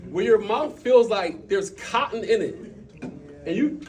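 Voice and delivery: high, loud and slowed; emphatic and animated; excited. Real, not acted. Excited cotton mouth explanation.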